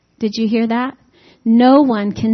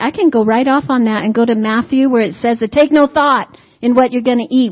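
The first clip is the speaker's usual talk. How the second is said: ordinary talk, nothing marked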